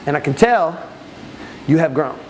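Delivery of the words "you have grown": usual speaking voice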